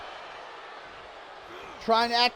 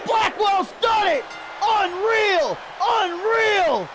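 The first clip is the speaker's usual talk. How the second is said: wrestling commentator; 8 semitones higher, slower than usual. Acted. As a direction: scream the finish like a commentator. Finisher call, pin, win.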